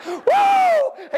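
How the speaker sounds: loud, high-pitched preacher's whoop; exultant whoop. Real, not acted. Preacher rejoicing at old brother's words, exultant whoop. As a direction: let out a long, piercing "woo".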